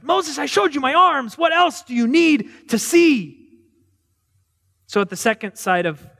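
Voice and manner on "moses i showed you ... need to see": a bragging God scolding Moses to get back in; exasperated God. Acted. Preacher voicing God telling Moses to get back in, high and incredulous. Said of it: "which one did he use this time".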